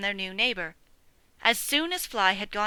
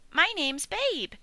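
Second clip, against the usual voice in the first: high, cheerful piglet voice; friendly bright greeting; jolly young pig; 8 semitones higher, slower than usual. Acted. Piglet introducing himself to sheep, jolly high voice. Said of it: "he said in a jolly voice".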